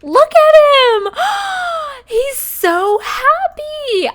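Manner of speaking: high, loud squeal of real surprise; shocked delight. Real, not acted. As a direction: gasp and shriek with joy at his reaction. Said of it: "I'm honestly so shocked right now".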